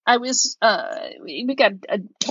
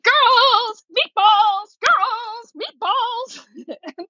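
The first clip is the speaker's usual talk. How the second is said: calling the dogs; 12 semitones higher, much slower than usual. Retold or imitated. Owner luring the dogs with meatballs, high sing-song call. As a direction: lure them in with a bright call.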